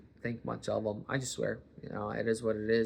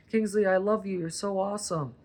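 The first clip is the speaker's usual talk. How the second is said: higher, sing-song praise for the dog; sweet dog talk; 7 semitones higher than usual. Shown as a demonstration. Telling the dog Kingsley he is loved in a cooing voice. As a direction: coo to the dog. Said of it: "Even the dogs can tell".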